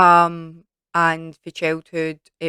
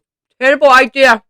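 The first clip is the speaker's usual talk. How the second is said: loud, high, disgusted; disgusted outburst; 8 semitones higher, 15 dB louder than usual. Real, not acted. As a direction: shout it after a foul taste. Tasting spoiled milk jellybean.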